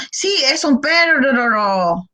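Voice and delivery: slow, trilling "perro" overlong; the child over-trills "perro" trying hard; stretched effortful "perro"; child straining at rr. Retold or imitated. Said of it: "Trata de hacerlo".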